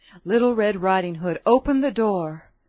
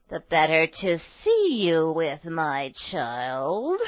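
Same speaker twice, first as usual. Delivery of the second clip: lower, slow menacing wolf voice; sly wolf; 6 semitones lower, much slower than usual. A narrator's reading. Wolf replies.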